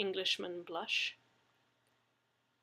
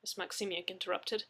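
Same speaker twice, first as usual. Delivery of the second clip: ordinary delivery, nothing marked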